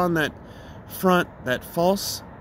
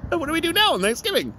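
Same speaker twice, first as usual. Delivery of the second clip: fast, much higher, giddy; high excited question; 11 semitones higher, faster than usual. Real, not acted. Telling about a Thanksgiving with nothing left to do, excited and high. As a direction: toss the question up high and quick. Laughing, then asking again.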